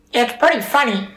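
very high, silly cartoon-kid voice; high-pitched put-on little-boy voice; Butters impression. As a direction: squeak it out as if on helium. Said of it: "order and do that voice".

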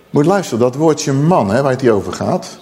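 usual speaking voice

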